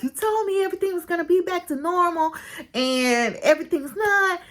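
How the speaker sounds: high, quick retold protest; upset complaint. Upset daughter takes it out on her mom, acted complaint. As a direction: imitate a child blaming her mother. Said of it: "she takes it out on her mom".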